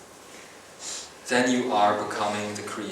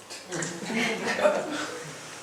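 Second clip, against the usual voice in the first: a real, high, light giggle; high laugh; 12 semitones higher, 6 dB quieter than usual. Real, not acted. Laughing at name mix-up.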